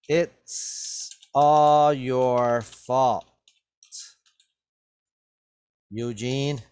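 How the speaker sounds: very slow, drawn-out accusing voice; blaming. Acted. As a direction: pin the blame on Eugene, stretching every word. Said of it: "Benson blamed".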